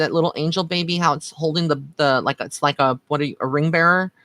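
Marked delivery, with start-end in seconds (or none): none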